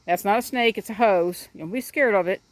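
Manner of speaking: ordinary talk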